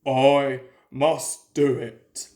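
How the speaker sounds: low, slow, effortful chant; low, slow self-urging of a straining engine; Gordon urging himself. A narrator's reading. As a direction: grind it out step by step up the hill. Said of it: "he told himself".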